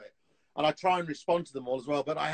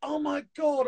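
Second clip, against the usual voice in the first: higher pitched, stunned gasp of a phrase; astonished; 9 semitones higher, slower than usual. Retold or imitated. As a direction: act star-struck and amazed. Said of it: "And I'm like".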